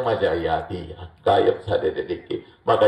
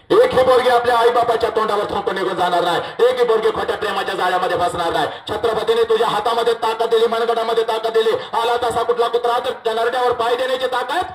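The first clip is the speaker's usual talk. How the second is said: fiery stage oration, mostly higher; full-force preaching; 12 semitones higher than usual. Acted. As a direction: preach it like a battle call to daughters. Rousing the crowd of girls.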